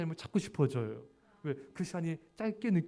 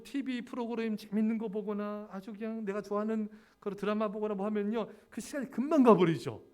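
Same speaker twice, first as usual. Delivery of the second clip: normal talking voice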